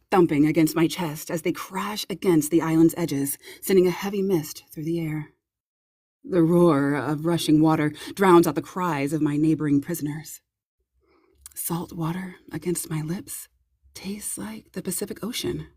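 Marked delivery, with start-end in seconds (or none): none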